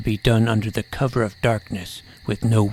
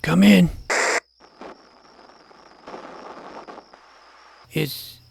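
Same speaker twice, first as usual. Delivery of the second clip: anxious calling; 7 semitones higher than usual. Acted.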